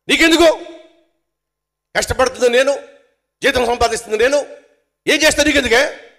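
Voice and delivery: high, loud ranting yell held to the end; angry husband shout. Retold or imitated. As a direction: scream it like a husband who won't be questioned. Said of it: "అన్నాడు అనుకోండి". (జీతం గురించి అడిగిన భార్యపై కోపంగా అరుస్తూ)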